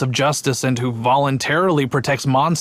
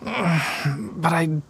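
weary sigh; 3 semitones higher, 4 dB quieter than usual. Acted. Guard sighs in frustration.

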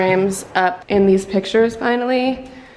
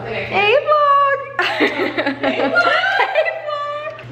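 much higher, giggling hello; laughing greeting; much slower than usual. Real, not acted. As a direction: keep trying to greet, can't stop laughing. Greeting the camera, cracking up.

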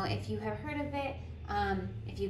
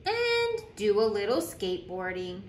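lively storytime voice, bouncing pitch; animated picture-book reading; reading to children; 3 semitones higher than usual. Acted. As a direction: read it like a teacher at story circle. Reads picture book aloud.

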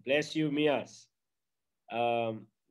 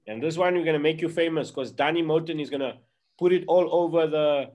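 normal talking voice

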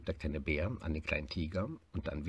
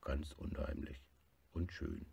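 hushed contented agreement; soft bear reply; 4 semitones lower, 9 dB quieter than usual. Acted. Bear quietly agrees with tiger. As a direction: lower and much softer, near whisper.

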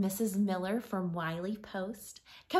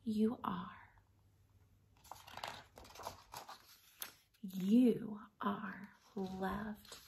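tender picture book reading; reading to children. Acted. Teacher reads picture book aloud.

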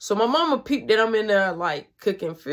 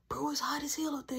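whisper; 5 semitones higher, 10 dB quieter than usual. Retold or imitated. Secret night talk.

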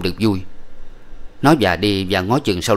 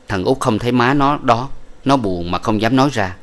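ordinary delivery

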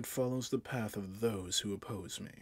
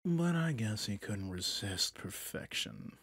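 ordinary talk, nothing marked

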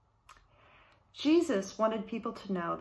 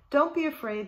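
higher, louder call into the dark; 7 semitones higher, 5 dB louder than usual. A narrator's reading. Shepherd calling out to the lost lamb in the dark. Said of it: "the shepherd called out".

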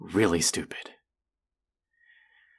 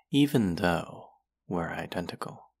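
ordinary delivery

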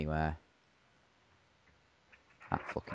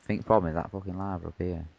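usual speaking voice

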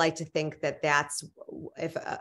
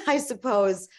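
laughing; 6 semitones higher, 4 dB louder than usual. Real, not acted. Guest laughs at own answer.

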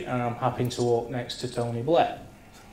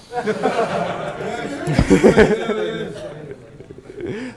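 breathless giggle; real laughter; high giggling laugh; 8 semitones higher than usual. Real, not acted. Laughing after joke.

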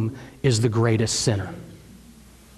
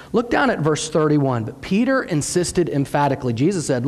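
usual speaking voice

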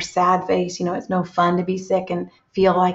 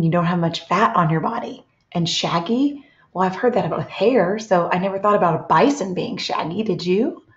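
normal talking voice

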